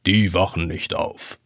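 put-on old clock calming the boy; reassuring. A narrator's reading. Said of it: "beruhigt ihn die Uhr".

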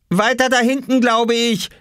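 put-on high, urgent boyish directions; calling down. A narrator's reading. Oskar at the window guiding his mother, calling down. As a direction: call directions to someone below. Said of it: "rief er".

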